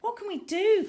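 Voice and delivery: high, loud cry for help; desperate cry. Acted. Tara panicking on the surface, crying out high and loud. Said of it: "cried Tara".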